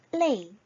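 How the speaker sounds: crisp Chinese demo; Chinese model word. Shown as a demonstration. Teacher models the word for tired. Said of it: "레이 의미는".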